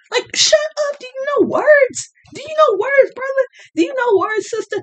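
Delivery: very high-pitched mock pouting whimper and scolding; mock pouty baby voice. Mocking a pouting adult who won't use words, high baby voice. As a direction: put on a squeaky pouter's voice. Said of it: "puppy dog face and the baby voice".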